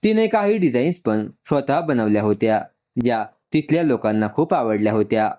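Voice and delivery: plain reading voice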